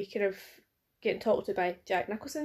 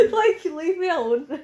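high, loud and laughing; laughing protest; 8 semitones higher, 7 dB louder, slower than usual. Real, not acted. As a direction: protest through laughter at being teased. Teased about her bad accent.

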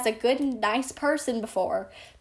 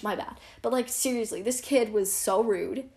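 ordinary delivery

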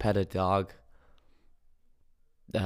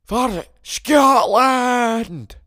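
huge, high, drawn-out Scottish battle yell; Braveheart war cry; 13 dB louder, much slower than usual. Pretend. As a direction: roar it like a Scottish rallying cry. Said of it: "some Braveheart type energy".

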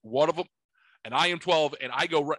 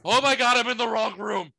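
much higher, louder startled cry; shocked realization; 8 semitones higher, 3 dB louder than usual. Retold or imitated. Kid walking into wrong hotel room, shocked cry. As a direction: gasp it out in sudden horror. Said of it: "right in and go".

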